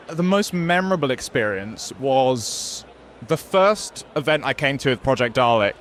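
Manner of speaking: ordinary talk, nothing marked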